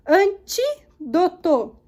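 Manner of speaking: "Antídoto" slowly in separate syllables, TÍ stressed. Shown as a demonstration. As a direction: break "Antídoto" into AN-TÍ-DO-TO, lifting TÍ. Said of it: "antepenúltima". ("Antídoto" sílaba por sílaba, com força no TÍ)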